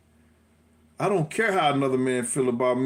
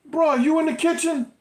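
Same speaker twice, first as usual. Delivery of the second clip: yelling across the room; 13 semitones higher than usual. Real, not acted. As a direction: call out to someone out of sight. Calling to brother in house.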